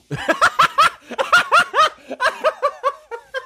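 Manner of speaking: shrieking real laughter; burst of high laughter. Real, not acted. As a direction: howl with laughter. Howling at the DJ name.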